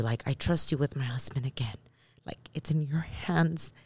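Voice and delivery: softly retold prayer, trailing into near-whisper; hushed prayer. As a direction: pray it quietly, almost to yourself. Retold private prayer, voice drops.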